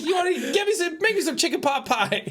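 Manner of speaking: laughing high mouse voice; high cartoon mouse voice. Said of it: "He's like".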